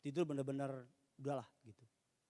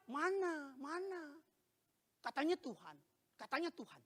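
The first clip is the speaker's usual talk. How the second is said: mock complaining; 14 semitones higher, slower than usual. Grumbler questioning where God is, mock whiny complaint. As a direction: grumble doubtfully at a high pitch. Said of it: "Kalau keluhan".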